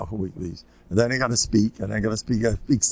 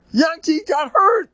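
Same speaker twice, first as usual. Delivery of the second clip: put-on high, louder kid voice, alarmed; breathless little boy reporting an injury; alarmed child; 6 dB louder than usual. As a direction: blurt the news like a scared six-year-old. Kid reports friend hurt.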